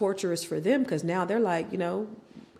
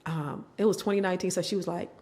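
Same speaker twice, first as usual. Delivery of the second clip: ordinary talk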